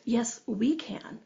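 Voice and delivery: slow, hushed, whispery reply; quiet voice of calm realization; calm body. Retold or imitated. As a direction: let it out softly and slowly, serenely. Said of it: "calm body just went".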